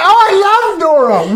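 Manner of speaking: put-on high little girl voice; delighted kid. Stepdaughter telling her stepdad she loves the show, high child voice. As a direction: say it like a happy small girl.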